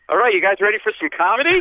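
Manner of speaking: brightly oblivious emcee hyping the room; MC announcing. Retold or imitated. MC after a bar fight, upbeat and loud. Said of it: "he goes".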